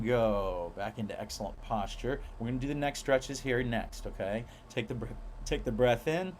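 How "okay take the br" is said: ordinary delivery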